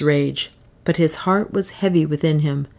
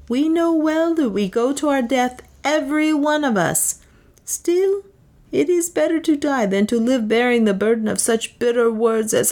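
lightly voiced, higher headman pleading; a hint of a worried headman, pitched up; Peter the headman; 7 semitones higher than usual. A narrator's reading. Headman asking Leonard to let them turn back, lightly pitched up.

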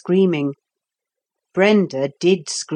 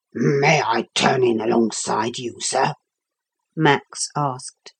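lower, polite dog voice, a little forlorn; a sober dog asking for company; lonely dog Max; 5 semitones lower than usual. A narrator's reading.

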